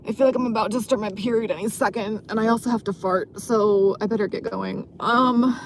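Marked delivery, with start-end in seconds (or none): none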